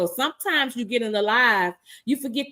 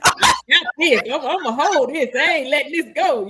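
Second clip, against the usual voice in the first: defiant bravado; 4 semitones higher, 4 dB louder than usual. Pretend. Acting prideful stance.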